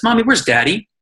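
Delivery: put-on high little-girl voice; lightly voiced little girl. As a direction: ask it like a small curious child. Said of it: "she says".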